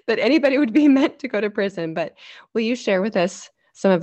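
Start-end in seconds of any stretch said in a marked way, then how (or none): 0.0-1.9 laughing. Real, not acted. Laughs while objecting to idea.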